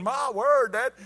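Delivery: high, loud mock protest; exasperated son. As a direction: cry out in hammed-up alarm. Stung son protests to dad.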